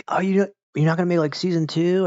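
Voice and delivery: mock pleading question; imitated fan plea. Acting out disappointed fans asking.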